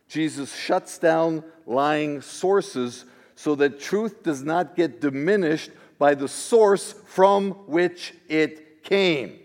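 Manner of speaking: ordinary delivery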